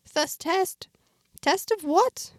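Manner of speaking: high, incredulous questions; confused disbelief. Acted. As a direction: echo the words in bewildered surprise. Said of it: "Now I was even more confused".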